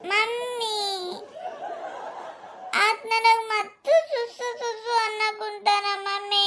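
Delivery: put-on high, slow, tearful child's wail; wailing child voice, sing-song sobbing; crying little girl. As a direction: cry to mommy about the teasing. Little girl cries to mother.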